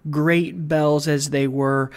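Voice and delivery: usual speaking voice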